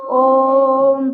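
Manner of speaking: ओम held long; drawn-out om. Shown as a demonstration. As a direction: stretch ओम like a chant. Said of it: "लंबा खींचा जाता है ओम को".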